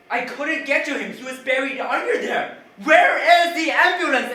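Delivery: frantic high yell, building to a scream; distraught, full-voiced outburst; panicked shouting. Retold panicked shouting at PR lady.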